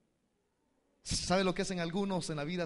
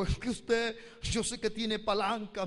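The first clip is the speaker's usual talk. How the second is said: a higher, pleading mother's voice; 7 semitones higher than usual. Retold or imitated. Mother begging the pastor, higher and pleading. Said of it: "Ella me dijo".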